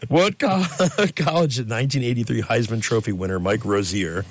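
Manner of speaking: usual speaking voice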